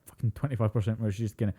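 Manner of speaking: ordinary talk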